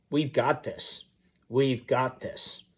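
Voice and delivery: calm her down gently; reassuring dad. Retold or imitated. Dad soothing his daughter.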